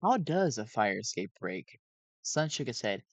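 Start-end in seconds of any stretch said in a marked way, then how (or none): none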